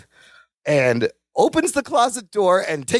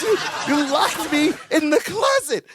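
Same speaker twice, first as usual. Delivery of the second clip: mock outraged shout, laughing through it; laughing mock outrage; retold angry yell; 7 semitones higher than usual. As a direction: yell it like an indignant roommate, half laughing. Larry confronts Balky, retold scene.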